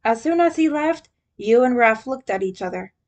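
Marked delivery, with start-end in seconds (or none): none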